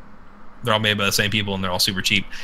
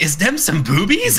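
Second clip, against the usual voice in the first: put-on higher, slower, childlike question; imitating his son; 6 semitones higher, 3 dB louder, slower than usual. Dad quoting his son about his wallpaper, in a higher, slow kid voice. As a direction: put on a curious little kid's voice. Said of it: "my son's always like".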